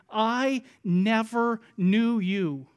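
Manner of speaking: drawn-out, very slow verdict; slow, deliberate pronouncement; solemn disowning. Acted. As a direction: space every word out with weight. Solemn verdict quoted in Greek sense.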